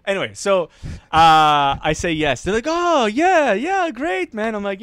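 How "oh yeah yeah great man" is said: high, loud, bubbly yeah great man; imitated cheerful man. Storyteller imitating a delighted man handing out water. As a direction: gush like an overjoyed stranger.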